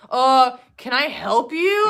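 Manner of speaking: imagine snapping at the waitress; mock snippy comeback. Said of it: "snap on her and be like".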